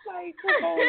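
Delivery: said through a laugh. Real, not acted. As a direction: laugh out the words.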